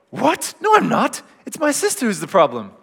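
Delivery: a playful mock-outraged denial; indignant disbelief. Imagined Martha's indignant reply.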